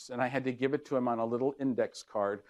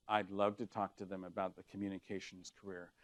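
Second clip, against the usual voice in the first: lowered voice; 6 semitones lower, 14 dB quieter, faster than usual. Shown as a demonstration. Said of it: "so you just say".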